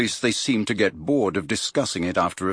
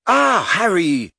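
louder, higher, slow Ah Harry; loud interruption; 10 semitones higher, 5 dB louder than usual. A narrator's reading. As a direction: cut across her brightly. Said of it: "said Missus Weasley loudly".

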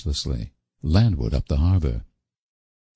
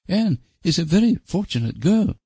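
high, lightly voiced older aunt, pleased; a hint of a satisfied old aunt, pitched high; Aunt Jamesina; 13 semitones higher than usual. A narrator's reading. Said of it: "said Aunt Jamesina".

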